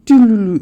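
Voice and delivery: high trilled "doo-doo-doo"; imitated instrument riff. Showing how the track begins, a high imitated riff. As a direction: mimic a quick high instrument run. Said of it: "it goes".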